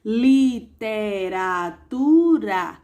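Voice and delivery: Literatura very slow, li-te-ra-tu-ra beat by beat; split into syllables. Shown as a demonstration. Teacher showing pupils a five-syllable word, slowly syllabified. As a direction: count Literatura out in five beats. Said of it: "cinco sílabas".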